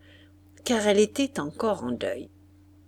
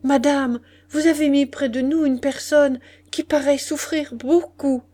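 higher, louder, pressing complaint; urgent address; 6 semitones higher, 6 dB louder than usual. A narrator's reading. Marquise tells the innkeeper someone suffers next door, urgent.

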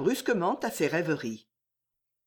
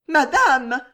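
desperate; 8 semitones higher, 9 dB louder than usual. Acted. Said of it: "regard désespéré".

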